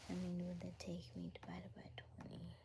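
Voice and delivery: quick whispered math to self; soft whispered working out. Real, not acted. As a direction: whisper the sum under your breath. Whispered calculation.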